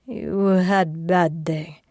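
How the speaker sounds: put-on slow tender accented voice; gentle Russian accent. Sasha soothing her.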